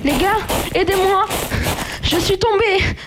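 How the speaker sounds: high frantic calls for help; panicked cry for help. Acted. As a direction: cry out for help after falling. Fallen during escape, calls for help.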